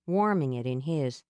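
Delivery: usual speaking voice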